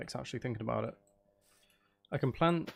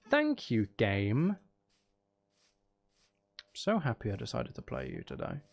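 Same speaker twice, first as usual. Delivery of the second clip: sarcastic. Real, not acted. Game burned his logs.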